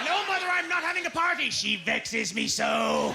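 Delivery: whiny villain. Acted. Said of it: "broke-ass James Bond villain".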